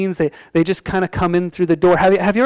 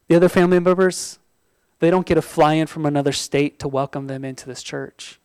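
normal talking voice

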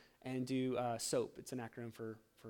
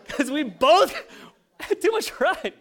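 much higher and louder, laughing through it; 11 semitones higher, 11 dB louder, slower than usual. Real, not acted. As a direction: laugh while confessing the pride. Laughing at their shared pride.

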